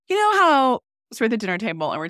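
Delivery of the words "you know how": mock teen daughter. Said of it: "But Gabby goes".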